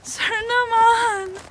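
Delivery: very high crying plea in Tagalog; tearful whine. Retold or imitated. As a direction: wail it, on the edge of tears. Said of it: "I really speak like that".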